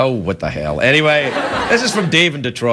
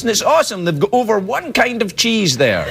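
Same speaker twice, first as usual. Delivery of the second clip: ordinary talk, nothing marked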